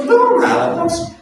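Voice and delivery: very high, mock-innocent brush-off; high-pitched, feigned nothing-happened voice; dismissive denial. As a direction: play a sinner shrugging it off. Acting sinner brushing off a question.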